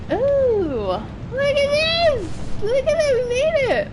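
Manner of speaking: very high, squealed excitement; giddy high-pitched triumph; excited delight. Real, not acted. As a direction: squeal it high, thrilled to have arrived. Delighted at reaching a new place.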